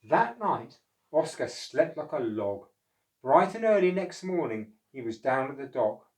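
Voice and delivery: put-on lively read-aloud voice for children; storybook narration. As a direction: tell it like a bedtime picture book.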